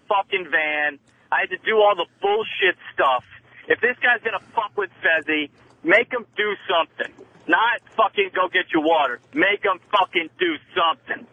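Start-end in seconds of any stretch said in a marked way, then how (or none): none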